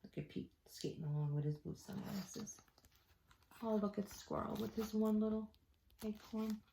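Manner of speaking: put-on soft aside to the kids; quiet aside. Reader pointing out Squirrel to the kids, softly. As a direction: murmur it to the listeners.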